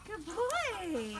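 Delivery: high falling praise; high coo, drop at the end; squeaky praising a dog. Acted.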